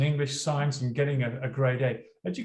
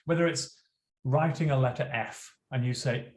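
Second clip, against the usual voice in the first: normal talking voice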